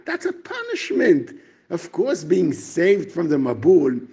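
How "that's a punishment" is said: pitched way up, like revealing a twist; surprising claim. Shown as a demonstration. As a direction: punishment lands high and startling. Chatam Sofer's surprising claim.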